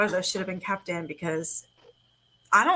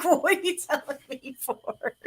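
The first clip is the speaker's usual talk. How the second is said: laughing exasperation; 6 semitones higher, slower than usual. Retold or imitated. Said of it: "I'm like".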